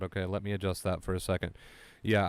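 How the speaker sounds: ordinary delivery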